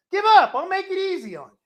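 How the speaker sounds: high, loud barked demand, then a fast mocking offer; preacher replaying the enemy's demand to surrender; taunting Assyrian. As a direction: sneer it at the besieged city.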